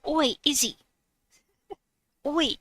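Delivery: a light Australian "Oi" greeting; Australian accent. Retold or imitated. Reading a viewer's greeting aloud in a light Australian accent. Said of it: "I have to think that in an Australian accent".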